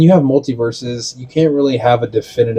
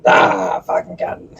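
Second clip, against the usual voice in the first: exasperated groan; 7 semitones higher, much slower than usual. Retold or imitated. Groaning at friends' crude joke.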